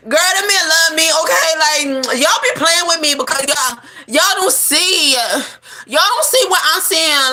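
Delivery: high, insistent deluded friend; Keisha voice. Acted. Keisha insisting to her friends, high and pleading. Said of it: "And she like".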